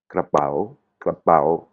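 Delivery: KRAPAO with a bend in the pitch on "pao"; "krapao" with a broken Thai intonation. Shown as a demonstration. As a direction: break the tone on the end of "krapao". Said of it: "il est cassé".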